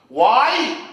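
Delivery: usual speaking voice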